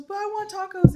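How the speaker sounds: high mock whine of a child; crying little boy. Retelling a boy begging mom for tacos, mock crying. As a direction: plead like a little boy, pitched right up. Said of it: "And he goes".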